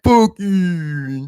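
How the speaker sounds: high, drawn-out mock holler; retold yell. Reckless driver yells back at the man he woke, high mock holler. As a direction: imitate someone yelling from a speeding car.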